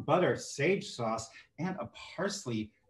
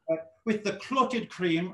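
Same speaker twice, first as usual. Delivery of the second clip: mock British accent; 5 semitones higher, 3 dB louder than usual. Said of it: "I'm sorry, I don't know why I did that".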